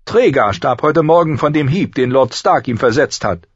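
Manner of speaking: high, loud accusation; accusing queen. A narrator's reading. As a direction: report it pointedly as the queen.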